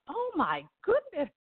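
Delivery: high-pitched, amazed aside; retold awe. Reporter watching the acting on set, high-voiced awe. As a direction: marvel at it in a high voice. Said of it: "looking at this in awe".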